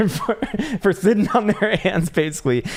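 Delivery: cracking up mid-sentence at his own joke; real giggle breaking through the words; laughing. Real, not acted. Joke about green dildo.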